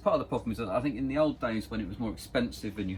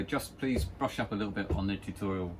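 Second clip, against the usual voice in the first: usual speaking voice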